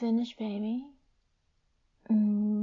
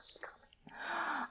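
breathy whisper; 11 semitones higher, 9 dB quieter, much slower than usual. Acted. Climax whisper.

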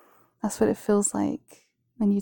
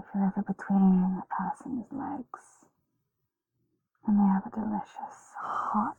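erotic ASMR whisper. Acted. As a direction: tease them in a hushed voice.

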